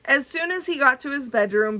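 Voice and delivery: ordinary delivery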